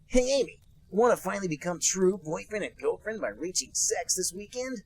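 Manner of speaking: high, loud eager Sonic voice; a hammed-up cartoon hero asking. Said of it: "and said".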